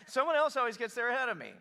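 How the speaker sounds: loud, high, quick complaint; put-on whining grievance; whiner. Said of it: "I think he's a whiner".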